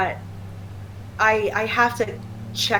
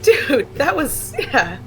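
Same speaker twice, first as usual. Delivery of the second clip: laughing; 7 semitones higher, 5 dB louder, much slower than usual. Real, not acted. Laughing at overreaction story.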